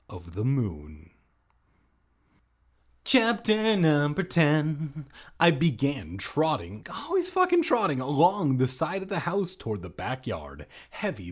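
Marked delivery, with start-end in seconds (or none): 3.0-5.1 slow, loud dramatic chapter title; announcer voice. Acted. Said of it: "announcing chapter". 6.9-8.0 exasperated. Real, not acted. Exasperated joke aside.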